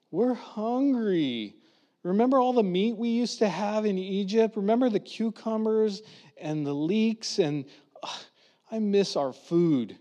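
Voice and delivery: whining complaint. Retold or imitated. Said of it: "they whine and complain".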